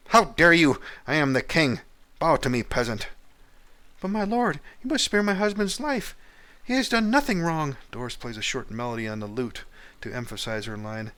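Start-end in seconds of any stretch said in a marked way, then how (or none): 0.1-3.1 loud high royal outrage; a king's enraged command; furious king. Acted. King rages at the peasant. 4.0-7.8 much higher pleading falsetto; high-pitched wife begging mercy for her husband. Acted.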